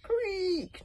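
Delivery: high drawn-out creak; creaky door sound. A narrator's reading. Narrator creaks like the old door. Sound effect in story reading.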